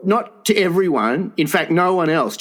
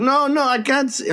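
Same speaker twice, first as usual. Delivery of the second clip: attempted Japanese accent; 9 semitones higher than usual. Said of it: "I can't do a Japanese".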